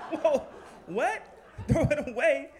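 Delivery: high, loud, laughing shock; stunned, can't believe it; high shocked laughing disbelief. Retold or imitated. Shocked at postcard.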